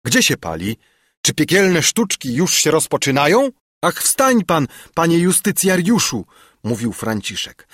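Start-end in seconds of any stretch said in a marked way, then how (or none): 0.1-3.5 mildly alarmed, raised-voice questions; rising indignation of a startled sleeper; calling out. A narrator's reading. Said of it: "dziadek obudził się i zawołał". 3.8-6.2 high, louder plea to get up; pressing servant's call; urgent calling. A narrator's reading. Servant urges master to rise.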